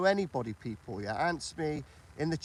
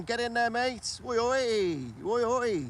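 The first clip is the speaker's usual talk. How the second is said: calling out; 5 semitones higher, slower than usual. Real, not acted. Greeting a viewer joining stream.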